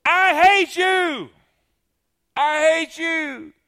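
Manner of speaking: high, loud, slow tantrum yell; boy yelling in a tantrum; furious child. Acted. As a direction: scream it like a hurt little boy. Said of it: "all tore out the frame".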